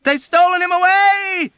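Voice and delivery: high shrill panicked scream. A narrator's reading. A patient screaming to the ward. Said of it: "screamed someone else".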